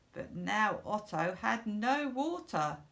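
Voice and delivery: usual speaking voice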